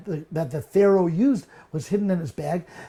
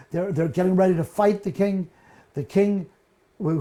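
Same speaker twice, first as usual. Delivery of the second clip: normal talking voice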